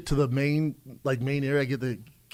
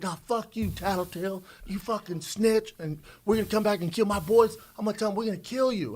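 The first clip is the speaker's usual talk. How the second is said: pimp voice; 7 semitones higher than usual. Retold or imitated. Comedian imitates the pimp calling him a snitch, higher taunting voice. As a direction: sneer it in a raised voice. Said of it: "He's like".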